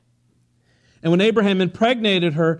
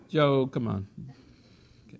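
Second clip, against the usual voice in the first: ordinary talk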